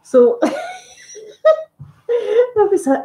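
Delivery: high, slow laugh breaking through; embarrassed laugh. Real, not acted. Recalling the awkward supermarket meeting, slow embarrassed laughing. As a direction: laugh it off, still mortified. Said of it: "I feel my face getting warm".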